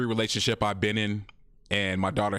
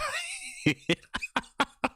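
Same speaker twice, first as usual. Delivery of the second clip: high giggle. Real, not acted. Laughing at animation.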